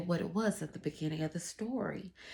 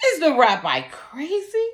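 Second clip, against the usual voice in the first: incredulous thought; 6 semitones higher, 12 dB louder than usual. Acted. Man's thought voiced.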